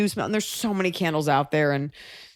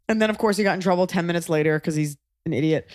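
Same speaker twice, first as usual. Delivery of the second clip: normal talking voice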